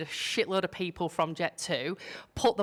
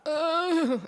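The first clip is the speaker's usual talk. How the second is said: strangled high ugh; 11 semitones higher, 5 dB louder than usual. Retold or imitated. Freezing at the Jet2 staff.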